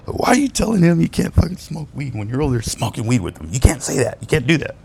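high-voiced retold telling-off; pissed off. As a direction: imitate an angry partner telling someone off. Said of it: "Vanessa was pissed off".

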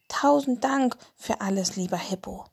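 plain reading voice

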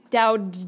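Dowd with a hard final D; Dowd, its closing D sounded out; stressed final D. Shown as a demonstration. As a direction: land clearly on the last D of Dowd. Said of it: "with a D".

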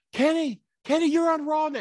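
very high, imitated excited phone message; excited callers. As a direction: squeal the news at a friend.

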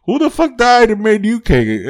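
high-pitched mock outrage; hammed-up, incredulous objection; mock indignation. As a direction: challenge an upstart in an exaggerated high voice. Acted indignant challenge to self-appointed leader.

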